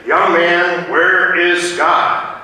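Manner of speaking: hammed-up towering pastor shouting; booming pastor. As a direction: thunder the question down at the child. Pastor towering, finger shaking.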